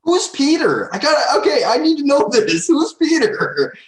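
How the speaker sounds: high, loud and laughing while asking; laughing demand. Real, not acted. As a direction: press the question through giggles. Demanding to know who Peter is.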